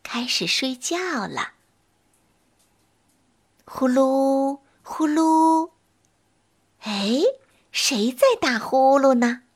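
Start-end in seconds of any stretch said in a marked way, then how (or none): 3.6-5.7 snoring sound. Acted. Witch snoring.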